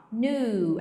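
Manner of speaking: Knew said as nu with a long u, no k sound; pronouncing knew. Shown as a demonstration. Teacher modeling the word for students, clear pronunciation. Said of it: "A pronúncia é como se você estivesse falando assim".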